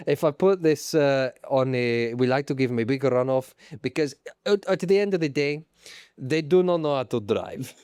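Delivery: put-on comic Italian accent; Stefano Domenicali. Boss introducing himself to the public, Italian accent. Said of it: "I'm Stefano Domenicali".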